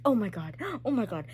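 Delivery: loud mock gasps of disbelief; gasping shock. Reader re-enacting shock at the book, gasping. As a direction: imitate being stunned, gasp between exclamations. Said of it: "literally gasping".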